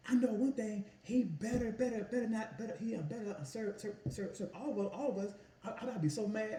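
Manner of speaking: high, whining complaint, stammering over serve; whiny girl. Retold or imitated. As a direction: mimic a petulant girl whining about being served. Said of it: "quit whining".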